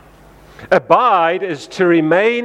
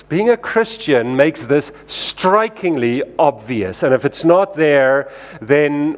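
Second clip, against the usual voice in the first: normal talking voice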